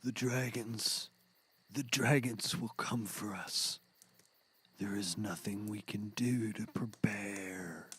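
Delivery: prophesy the dragons in a hush; doom-laden murmur. Acted. Peyote vision of doom.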